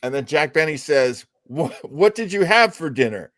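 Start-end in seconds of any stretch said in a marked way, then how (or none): none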